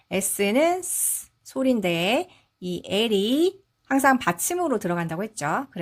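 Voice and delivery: ordinary delivery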